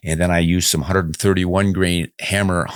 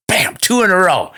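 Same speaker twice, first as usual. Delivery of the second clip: delighted cheer; 12 semitones higher, 3 dB louder than usual. Real, not acted. Cheers second kind comment.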